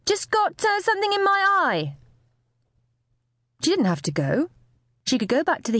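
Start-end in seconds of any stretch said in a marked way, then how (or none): 0.0-2.0 very high, strained excuse; high-pitched, hesitant cover-up; shrill call. A narrator's reading. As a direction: keep it shrill, covering up the tears. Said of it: "Amy shouted over shrilly".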